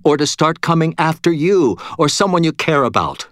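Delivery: high-pitched, driving argument; urgent insistence. A narrator's reading. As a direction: press the case hard, pitched well up. Murphy argues heatedly for striking immediately.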